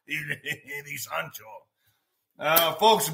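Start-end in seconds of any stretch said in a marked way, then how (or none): 0.1-1.4 low, slow muttered aside; quiet aside. Real, not acted. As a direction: drop it to a murmur.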